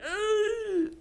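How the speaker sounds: high strained grunt while pushing. Retold or imitated. Mom mimicking laboring women, strained grunt. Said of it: "they're like".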